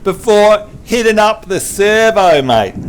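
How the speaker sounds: slow, broad Aussie question; Australian accent demo. Shown as a demonstration. Host models a phrase for the learner, Australian accent. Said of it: "show you how to talk Australian".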